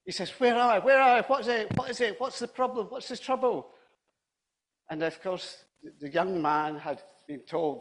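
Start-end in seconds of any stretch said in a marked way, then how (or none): 0.4-3.7 high, loud, panicky confusion; acted disorientation on waking with a start; startled awake. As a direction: blurt it out, just jolted from sleep. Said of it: "if you're falling asleep".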